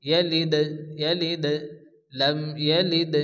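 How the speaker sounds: ইয়ালিদ with a lightly bounced final d; slight jolt. Shown as a demonstration. Teacher demonstrating qalqalah on dal, slight jolt ending ইয়ালিদ. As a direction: give the d ending ইয়ালিদ a small echo. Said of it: "হালকা একটু ঝটকা দিয়ে পড়তে হবে".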